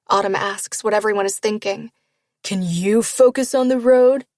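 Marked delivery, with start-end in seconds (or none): 2.4-4.2 lightly mocking, louder singsong copy; mocking mimicry. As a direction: parrot her words back mockingly. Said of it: "Waylon mimics her".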